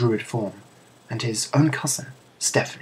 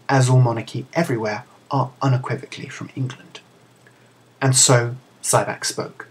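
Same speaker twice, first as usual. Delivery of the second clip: usual speaking voice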